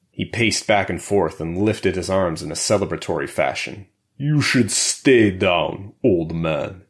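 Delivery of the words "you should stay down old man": put-on slow, heavy Russian-accented brute; thick Russian accent. A narrator's reading. Prison brute taunting the fallen old man, thick Russian accent. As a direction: lean hard into a Russian accent, drawn out. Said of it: "he said with a thick Russian accent".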